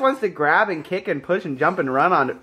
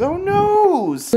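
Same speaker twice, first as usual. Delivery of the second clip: "noes" squeaked up very high; mock dismay, pitched way up; high baby talk; 14 semitones higher than usual. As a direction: coo "Oh, noes" in a high, cutesy voice. Cooing, repeated much higher.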